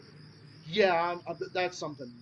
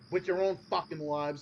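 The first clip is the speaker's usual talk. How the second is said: normal talking voice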